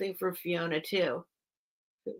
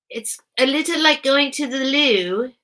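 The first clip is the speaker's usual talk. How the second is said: put-on British accent; 6 semitones higher, 7 dB louder than usual. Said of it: "How's my accent?".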